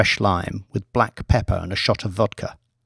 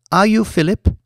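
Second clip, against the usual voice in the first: a higher, lightly voiced girl asking; young woman's voice; 10 semitones higher, 4 dB louder than usual. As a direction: step in as the tall young girl, curious. Young woman greets Banks, narrator-voiced.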